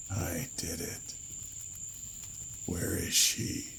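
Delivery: slow, dry, papery whisper; hoarse creature whisper. A narrator's reading. Cadaver asking Lloyd for her, slow hoarse whisper. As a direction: drag the words out like a corpse. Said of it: "the sound like the ruffling of crumpled paper".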